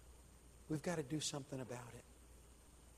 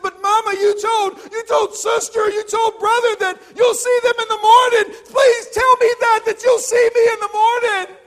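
desperate shout; 24 dB louder than usual. A narrator's reading. Son cries out at mother.

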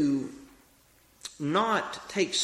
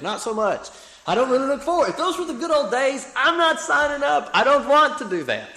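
higher and louder, laughing it off; emphatic refusal; 10 semitones higher, 7 dB louder than usual. Real, not acted. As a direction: push back hard, half joking. Mock recoiling from hard old days.

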